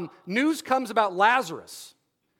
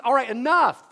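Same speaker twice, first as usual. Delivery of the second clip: louder, fed-up cutoff; 3 semitones higher, 7 dB louder than usual. Retold or imitated. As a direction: stop them, you've had it. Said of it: "maybe erupts to some degree".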